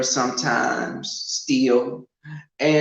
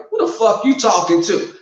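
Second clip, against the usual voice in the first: higher, louder retold challenge; snapping back; 6 semitones higher, 5 dB louder than usual. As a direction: fire it back at a boyfriend, indignant.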